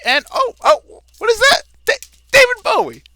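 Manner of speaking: high, loud cry of mock surprise. [name] feigning shock that a guest turned up, loud and high. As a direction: gasp out the discovery, loud and way up high.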